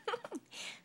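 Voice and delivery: high little laugh; Little Miss Sunshine giggles; light giggle. Acted. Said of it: "laughed Little Miss Sunshine".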